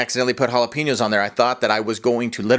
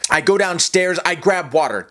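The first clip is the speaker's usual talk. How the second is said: normal talking voice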